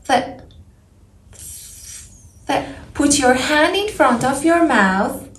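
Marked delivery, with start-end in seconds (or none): 0.1-2.7 "th" blown out through the teeth, no voice; "th" as a long airy hiss; voiceless th. Shown as a demonstration. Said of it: "Put your tongue between your teeth and blow air".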